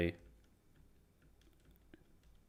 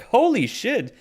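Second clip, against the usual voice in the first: high, loud holy shit; surprised exclamation; amazed; 13 semitones higher, 7 dB louder, slower than usual. Retold or imitated. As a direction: blurt it out in amazement. Retold amazed reaction.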